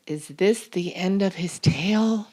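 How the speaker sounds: ordinary delivery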